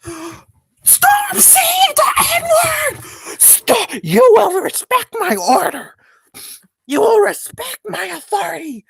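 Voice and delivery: high screamed rant, dragged-out words; hammed-up screaming meltdown, far above normal pitch; Copper raging. Streamer shows chat how Copper rages, shrieked mock fury. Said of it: "we can talk about Copper raging".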